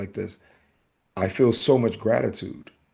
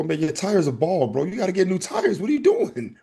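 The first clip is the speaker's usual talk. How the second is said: rising, pitched-up ribbing of a friend; teasing call-out; 8 semitones higher than usual. Retold or imitated. Teasing coworker about bald tires.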